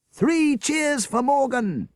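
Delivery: high, loud, slow rallying shout. A narrator's reading. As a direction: lead the crowd in cheers. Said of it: "he shouted".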